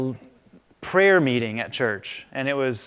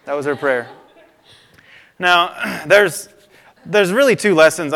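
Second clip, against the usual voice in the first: ordinary talk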